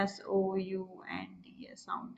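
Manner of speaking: spells the word out in a whisper; a hushed aside, letter by letter. Real, not acted. Teacher whispers the spelling to her class.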